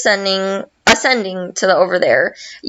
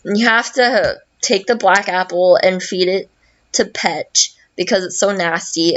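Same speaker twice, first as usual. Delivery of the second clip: ordinary delivery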